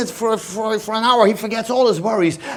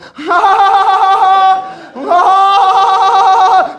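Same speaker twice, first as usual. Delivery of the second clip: laughs; 9 semitones higher, 6 dB louder than usual. Real, not acted. Laughs at his own story.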